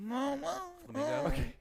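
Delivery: very high, stretched-out word; high drawn-out squeal. Pretend. Guest guesses which bird he resembles, high squeal. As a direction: squeak it out slowly as a question.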